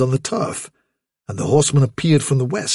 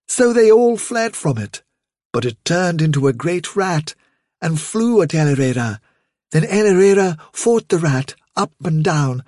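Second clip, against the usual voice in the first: higher-pitched mother's storytelling voice; lilting, higher storyteller; mother rabbit storytelling; 5 semitones higher than usual. Acted. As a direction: tell a tale to little ones in a higher voice. Vilthuril tells a story.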